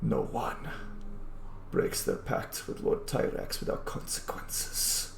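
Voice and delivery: whispered threat; menacing general. Acted. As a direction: hiss the insults softly, almost in his ear.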